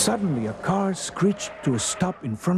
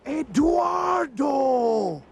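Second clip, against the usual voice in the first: high, slow, drawn-out announcement; triumphant reveal; 12 semitones higher, much slower than usual. A narrator's reading. Narrator revealing who popped from the sack, high proclamation. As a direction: proclaim the name grandly, stretched out.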